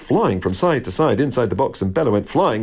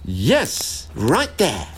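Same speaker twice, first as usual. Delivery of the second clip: higher, mildly excited squirrel; excited cry; 5 semitones higher, much slower than usual. A narrator's reading. Squirrel showing Jack the blocked hole, excited. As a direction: call out the spot eagerly. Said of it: "cried the squirrel, hopping up and down".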